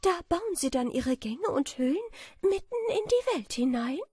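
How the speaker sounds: put-on high, light, dreamy girlish voice; a naive little butterfly imagining honey; sweet and high; innocent butterfly. A narrator's reading. Butterfly asks beetle.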